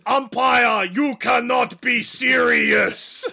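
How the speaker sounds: drawn-out, high monster complaint; slow hammed-up monster shout; outraged Bigfoot voice. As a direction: argue with the umpire as a monster. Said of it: "when me and you do his voice".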